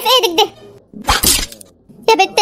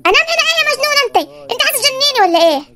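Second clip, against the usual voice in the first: a lightly voiced young bride, fast and a bit higher; indignant bride; 4 semitones higher, faster than usual. New bride refuses husband's sleeping plan, fast indignant voice. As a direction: toss it off quickly as the outraged new wife.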